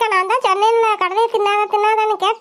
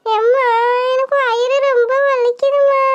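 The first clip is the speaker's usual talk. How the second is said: whimpering in pain; 2 semitones higher, slower than usual. Acted. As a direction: moan it to mom with a sore belly.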